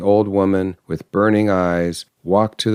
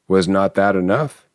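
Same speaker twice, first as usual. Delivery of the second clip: ordinary delivery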